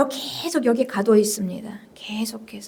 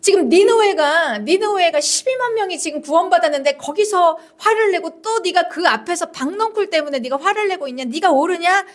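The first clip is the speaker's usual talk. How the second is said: raised, pressing interrogation; reproachful God; 6 semitones higher than usual. Acted. God challenging Jonah over the gourd, indignant reproach. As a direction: scold with question after question, pitched high. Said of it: "이게 두 번째 질문이에요".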